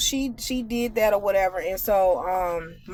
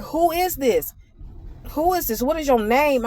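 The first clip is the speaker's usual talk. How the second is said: much higher, pushy, rude demanding; 5 semitones higher than usual. Retold or imitated. Coworker on the phone, pushy high rude demand. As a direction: imitate a caller demanding a name. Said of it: "She's like".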